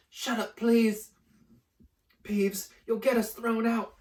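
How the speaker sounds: high, loud desperate hush; urgent plea. Acted. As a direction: beg him to stop, fast. Pleading with Peeves urgently.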